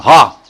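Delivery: a short, loud burst of a cry; sudden cry. Retold or imitated. Devotee crying out at a single cow's sound, loud sharp gasp. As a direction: blurt out one sharp cry. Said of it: "and you cry".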